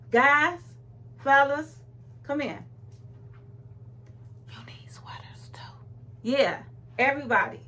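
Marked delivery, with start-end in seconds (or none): 0.1-2.6 calling out. Real, not acted. Calling out to male viewers. 4.5-5.6 breathy whispered aside. Acted. Aside to the guys.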